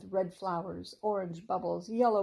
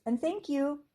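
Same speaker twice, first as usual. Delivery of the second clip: bright thankful voice; 8 semitones higher, 5 dB louder than usual. A narrator's reading. Rachel thanking squirrel.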